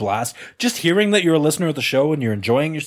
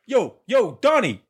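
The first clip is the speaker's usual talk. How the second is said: higher, hammed-up Italian-American wiseguy call; mock Italian accent; 7 semitones higher than usual. Wiseguy calling to Donnie, mock Italian accent.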